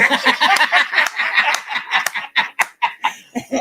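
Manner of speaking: a big high unvoiced laugh, fast; loud burst of laughter. Real, not acted. Podcast host cracking up at his own story, real loud laughter.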